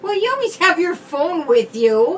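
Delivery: loud sing-song jab; accusing. Real, not acted. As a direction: needle him about the phone. Bickering about the phone.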